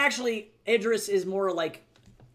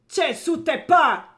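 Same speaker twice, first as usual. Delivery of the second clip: high, slow foreign shout; 10 semitones higher, 12 dB louder, much slower than usual. Acted. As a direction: yell it out in a foreign tongue. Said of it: "someone shouted in my general direction".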